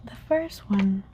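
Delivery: usual speaking voice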